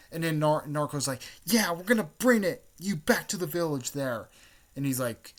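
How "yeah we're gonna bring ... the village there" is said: high, loud call, easing off towards the end; acted eager echo. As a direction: chime in like an excitable anime sidekick. Second character echoes the vow.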